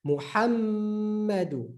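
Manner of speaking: Muhammadu with a long nasal hum on the mm; prolonged meem. Shown as a demonstration. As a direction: hold the mm in Muhammadu, slowly. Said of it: "is going to be prolonged".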